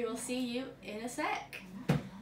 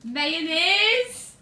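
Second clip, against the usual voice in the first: loud, high playful call; loud announcement; 6 semitones higher, 12 dB louder than usual. Real, not acted. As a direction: announce it with glee. Announcing the dreaded food.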